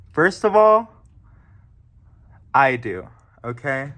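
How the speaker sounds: suddenly loud and offended; indignant outburst. Acted. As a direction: blurt it out, indignant. Offended by a rude question.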